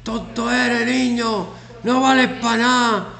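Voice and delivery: put-on loud, high, harsh yell at a child, slowing on the last insult; mock angry yell. Teacher demonstrates yelling at a child who got it wrong, mock furious shout. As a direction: bark the insults like a bullying teacher. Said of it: "Le grito".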